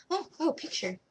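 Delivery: a sudden "oh!" of finding it; spotting the picture she needs; sudden realization. Real, not acted.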